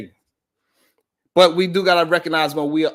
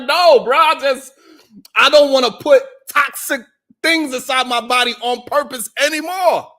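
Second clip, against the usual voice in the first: high, loud insistent denial; emphatic protest; 9 semitones higher, 5 dB louder than usual. Retold or imitated. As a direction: insist loudly you quit. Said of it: "I'm like".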